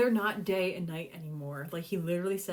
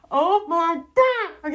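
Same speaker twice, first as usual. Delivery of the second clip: high, loud, very drawn out; excited squeal; 10 semitones higher, 13 dB louder, much slower than usual. Real, not acted. Reader breaking off from the farewell scene, squealing. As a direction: wail it out in delight. Thrilled outburst at the book.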